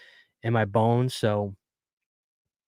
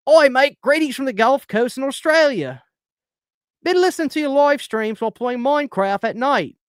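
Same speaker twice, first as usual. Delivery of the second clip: hammed-up Australian accent, much higher and louder; bad Australian accent; 13 semitones higher, 4 dB louder than usual. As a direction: overdo a cartoon Australian accent, pitched way up. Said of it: "I can't do an Australian accent".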